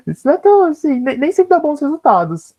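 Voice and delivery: high-pitched, through a laugh. Real, not acted. Speaker admits results aren't always good, laughing. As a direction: let the laugh lift your voice high.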